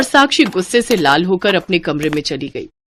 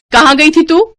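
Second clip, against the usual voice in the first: loud, high furious demand; angry scolding; 9 semitones higher, 7 dB louder than usual. Acted. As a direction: bark it at the girl. Angry sister-in-law scolds Ritika. (गुस्से में डांटती आवाज़)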